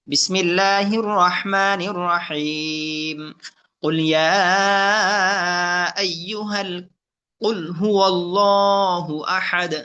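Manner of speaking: slow, measured tajweed chant; Quran recitation. Shown as a demonstration. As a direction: recite with drawn-out vowels. Recites Ikhlas.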